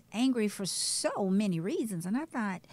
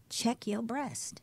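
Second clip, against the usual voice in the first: slow earnest plea; 2 semitones higher, 3 dB quieter, much slower than usual. Real, not acted. Urging listeners to self-check.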